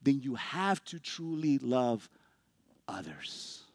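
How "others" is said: soft whispered ending. Acted. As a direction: drop to a whisper on the last word. Pointed ending of the sentence.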